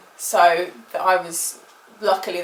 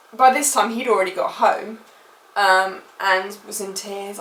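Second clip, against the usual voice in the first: ordinary talk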